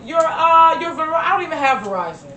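mock scammer. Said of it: "they be on the phone like".

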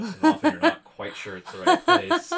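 high, loud laughing words. Real, not acted. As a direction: laugh through the line. Laughing while describing DoorDash.

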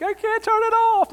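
very high, whiny put-on voice; mock crying friend. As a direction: squeak it like someone stuck crying.

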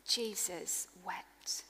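slow breathy whisper, almost no voice; a reverent hush on the two words; whispered scripture. Acted. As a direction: hush the room as you say it. Scripture climax read in hush.

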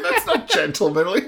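laughing mock prim protest; prim, giggling objection. Co-host mocking prim reaction, laughing. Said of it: "I was like".

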